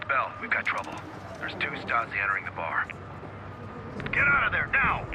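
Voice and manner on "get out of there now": loud, high-pitched yell to get out; urgent shouted warning. Acted. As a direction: shout it like lives depend on it. Urgent warning to escape the bar.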